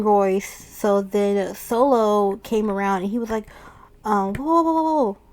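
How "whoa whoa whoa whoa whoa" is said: higher-pitched imitation of someone calling a halt; string it out as a mock "hold on"; lightly acted backing-off; mock halting plea. Imitating Solo halting a fight.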